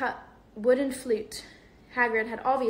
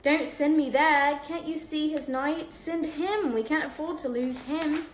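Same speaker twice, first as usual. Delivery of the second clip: shouting; 6 semitones higher than usual. Acted. Chess piece shouting advice.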